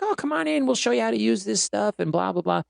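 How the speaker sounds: high-pitched imitation of an inviting neighbor; welcoming neighbor. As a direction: wave them in with a high, easy lilt. Retold neighbour's friendly invitation.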